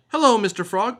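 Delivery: high, eager little butterfly; bright butterfly voice. Acted. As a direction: greet her like a curious small butterfly. Butterfly greets frog.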